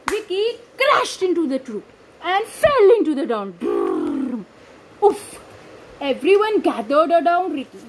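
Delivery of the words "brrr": very low brrr of the crash; deep rumbling crash noise; crash rumble. Acted. Crash sound effect.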